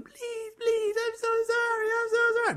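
tiny, very high squeaky pleading; pipsqueak voice. Acted. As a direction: beg in a teeny falsetto squeak. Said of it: "his little pipsqueak voice".

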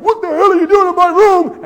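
high, loud shocked shout; startled yell. Retold or imitated. Startled man yelling at the naked intruder, high loud shout. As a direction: yell at the stranger who barged in.

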